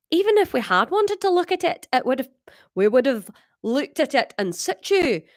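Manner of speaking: high Scottish character voice; Scottish accent. Acted. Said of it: "is that Scottish?".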